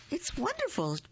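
very high little bird chirp; cheeped. A narrator's reading. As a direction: chirp it like a tiny happy bird. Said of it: "Bird cheeped".

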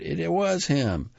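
high-pitched cry without much volume; mild shout, pitched well up; crowd's shout. A narrator's reading. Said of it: "shouted the throng".

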